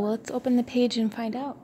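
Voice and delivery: ordinary delivery, nothing marked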